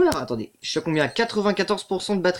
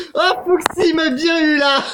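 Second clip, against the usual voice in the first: said through laughter, higher and slow; laughing; 7 semitones higher, 4 dB louder than usual. Real, not acted. Gamer to viewers after Foxy's jumpscare, laughing through it. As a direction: laugh while admitting defeat.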